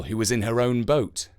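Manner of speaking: ordinary delivery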